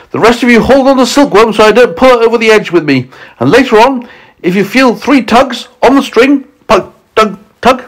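high, urgent instructions; crying out. A narrator's reading. James instructing his friends at the peach's edge, crying out. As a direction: call out orders before a rescue leap. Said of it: "cried James".

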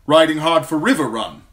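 loud, high, hurried messenger knight; urgent knight's report. A narrator's reading. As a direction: report from horseback in a rush. Sir Adam reporting urgently.

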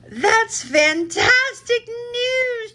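very high, slow, chirpy delight; chirped. A narrator's reading. Splat chirps the happy news back to Mom. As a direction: squeal each word out with joy. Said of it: "chirped Splat".

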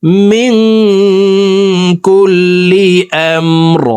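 slow, drawn-out melodic chant; slow tajweed chant; Quran recitation. Acted. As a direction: intone each word long and reverent.

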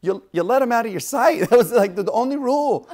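high, loud mock telling-off; hammed-up exasperated angel; exasperated scolding. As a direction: sputter in comic disbelief at the rule-breaker. Acting angel scolding Joseph again.

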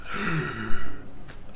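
big breath in after running out; gasp for air. Real, not acted. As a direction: player finished a long passage without breathing, gasping for air. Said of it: "I should probably have breathed during that".

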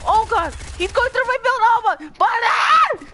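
high panicked shouting; shaky stammering panic. Real, not acted. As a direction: scream as the walls fall. Enemy shooting through his build.

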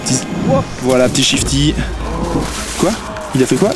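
usual speaking voice

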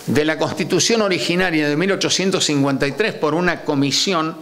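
normal talking voice

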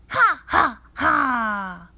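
high, loud witch laugh; mean witch cackle. Acted. Witch laughs again on stage.

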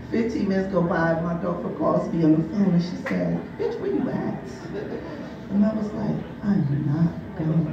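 usual speaking voice

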